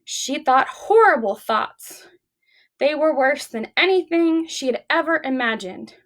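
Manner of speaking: ordinary delivery, nothing marked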